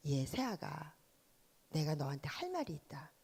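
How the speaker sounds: lightly voiced father-in-law, low and hushed; father-in-law quoted. Father-in-law addressing his new daughter-in-law, lightly voiced, hushed. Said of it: "우리 아버님이 이렇게 말씀하셨어요".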